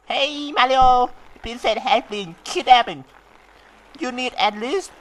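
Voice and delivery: high squeaky game voice; high, slow cartoon voice; voicing Toad. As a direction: read it like the little Mario helper. Reading game character dialogue.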